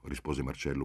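ordinary delivery, nothing marked

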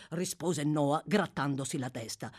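usual speaking voice

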